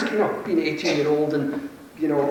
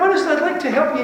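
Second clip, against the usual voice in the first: much higher and fast, keen to persuade; eager healer; 9 semitones higher, faster than usual. Retold or imitated. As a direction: push the offer brightly and quickly. Acting the confident healer.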